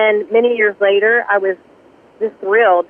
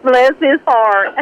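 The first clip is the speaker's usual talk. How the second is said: higher, drawn-out Southern drawl; 4 semitones higher, 3 dB louder, much slower than usual. Pretend. Guest echoing the host's joke, higher Southern drawl. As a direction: playfully stretch it out in Southern style. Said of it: "That's Southern".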